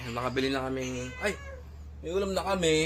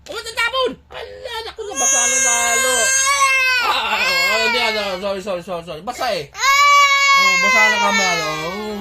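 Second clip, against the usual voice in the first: alarmed fuss; 6 semitones higher, 9 dB louder than usual. Real, not acted. Consoling crying child, spilled water.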